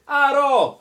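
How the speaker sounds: high, loud call of Arrow; calling horse. Retold or imitated. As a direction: bellow Arrow like the game hero. Imitating the game character calling.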